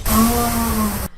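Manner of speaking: high, loud mock gasp of horror; mock outrage. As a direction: gasp like an offended prude. Mocking outraged journalist.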